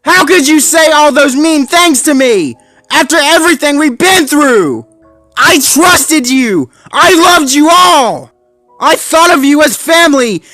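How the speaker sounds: angry shout. A narrator's reading. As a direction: scream out the betrayal. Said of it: "He growled".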